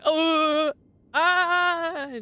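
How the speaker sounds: long, high, loud held scream; hammed-up charging yell, drawn out; mock battle scream. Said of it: "Just screaming like that".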